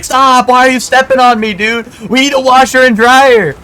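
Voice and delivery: high, loud squeaky rant; mock yell. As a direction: yell it like the fed-up roommate. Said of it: "And, and Lucor's like".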